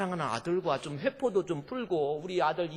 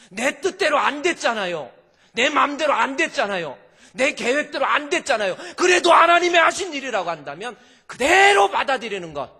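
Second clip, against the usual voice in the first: loud and much higher, insistent; fiery preaching; 9 semitones higher, 11 dB louder than usual. Acted. Preacher pressing the congregation to accept God's will, loud. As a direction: drive each phrase home.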